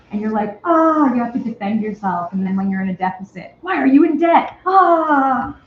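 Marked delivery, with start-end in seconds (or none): 0.6-1.1 high, loud "ah!" of alarm; raised exclamation of comic alarm; mock panicked cry. Retold panic when questioned about surplus. 3.6-4.6 high, loud imitation of an alarmed funder; exaggerated accusing question, pitched up; mock accusing demand. 4.7-5.6 high, loud "ah!" in mock horror; hammed-up wail of alarm; mock panicked scream. Mock panic after the debt question.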